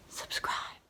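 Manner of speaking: whispered subscribe; whispered plea. Shown as a demonstration. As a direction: breathe it like a secret. Repeated plea, second whispered.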